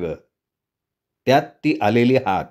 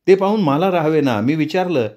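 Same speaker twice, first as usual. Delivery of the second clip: plain reading voice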